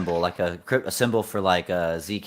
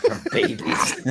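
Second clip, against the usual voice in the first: laughing; 3 semitones higher, slower than usual. Real, not acted. Echoing joke amid laughter.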